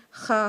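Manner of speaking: خا with a rough scrape at the back; gargle-like خا; Arabic khaa. Shown as a demonstration. Teacher shows the scraping خا sound. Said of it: "خا کی آواز ہوتی ہے نا".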